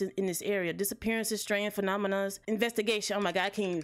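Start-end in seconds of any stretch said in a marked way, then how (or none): none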